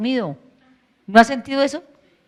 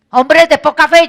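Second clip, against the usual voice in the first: "Hombres de poca fe" shouted higher and louder, stress on fe; loud rebuke; 5 semitones higher, 10 dB louder than usual. Acted. Jesus rebukes the disciples on the boat, "Hombres de poca fe" shouted. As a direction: bellow "Hombres de poca fe" as a scolding. Same rebuke retold twice.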